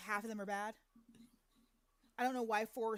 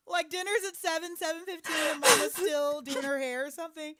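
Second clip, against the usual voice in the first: mocking; 5 semitones higher, 9 dB louder than usual. Real, not acted. Mocking hungry child.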